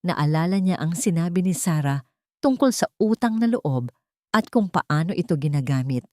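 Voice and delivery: plain reading voice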